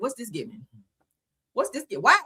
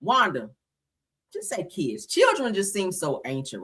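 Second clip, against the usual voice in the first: normal talking voice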